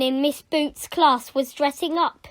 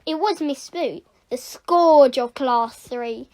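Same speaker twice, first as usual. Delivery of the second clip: ordinary delivery, nothing marked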